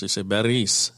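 Beris with a rolled r. Shown as a demonstration. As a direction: roll the r in Beris. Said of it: "you usually roll the R's".

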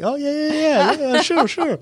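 imitating himself agreeing without understanding; mock eager agreement. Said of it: "And I was like".

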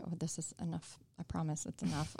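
softly voiced, low-key insistence; understated, hushed reassurance; quiet retold protest. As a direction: say it under your voice, as a retold reply. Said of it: "And I'm like".

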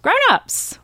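high-pitched mock parental plea; high, imploring quote of parents; imitated eager parents. Parents pleading, voiced in retelling.

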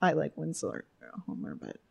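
quiet mumble while taking notes; mumbling. Real, not acted. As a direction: mutter it half to yourself. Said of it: "I'm mumbling into my own podcast".

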